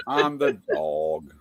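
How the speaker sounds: On the dog dragged out very slow and low; slow, drawn-out repeat. Retold or imitated. Host mulling over the dog excuse, drawn out slowly. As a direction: stretch On the dog out slowly, amused.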